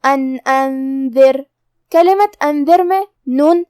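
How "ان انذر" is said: slow, drawn-out Quran recitation demo; tajweed recitation. Shown as a demonstration. Teacher demonstrating the rule to students, slow recitation. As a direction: recite each letter slowly and clearly. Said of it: "بغیر غنہ سے ادا کریں گے".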